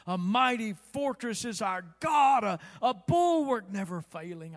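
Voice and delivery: ringing preacher's recitation; declaimed hymn line. Retold or imitated. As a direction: thunder the hymn line from the pulpit.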